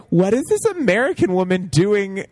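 high, incredulous mock question; high-pitched comic disbelief; mock bafflement. Mock bafflement at miscast actress.

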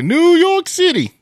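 high, loud showy shout of the city; hammed-up announcing. Leaving to catch the bus, hammed-up high shout. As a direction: belt out the city name like a big announcement.

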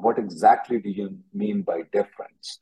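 usual speaking voice

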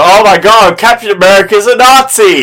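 loud, high-pitched shocked outcry; hammed-up scandalized yell; mock horror. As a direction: shout it like a scandalized young fan. Imitating a young reader's shocked discovery.

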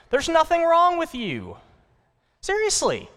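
preacher pressing the point home; emphatic reassurance. Real, not acted. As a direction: insist it from the heart. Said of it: "Can I speak into your life".

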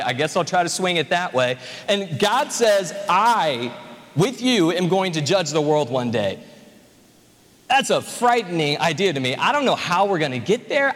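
normal talking voice

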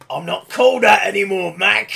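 loud mock outrage; acted indignant protest. Trapster rejecting his old name, loud indignation. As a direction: object loudly like a touchy actor.

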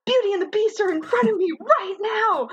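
put-on high starstruck kid voice; awed child. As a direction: gasp it out in wonder. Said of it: "I was just like".

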